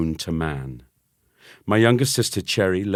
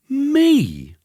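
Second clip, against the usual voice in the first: very high, loud indignant yelp; 6 dB louder than usual. A narrator's reading. Boy protesting to mum, very high and loud. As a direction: protest in shock.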